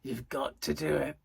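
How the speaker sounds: low, insistent tempter's voice; little shoulder devil. Retold or imitated. Devil on my shoulder urging mischief, low and insistent. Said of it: "this little devil on my shoulder going".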